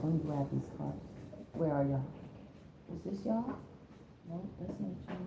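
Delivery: muttering to herself while hunting for cards; away from the mic. Real, not acted. Streamer searching for her cards, away from the mic.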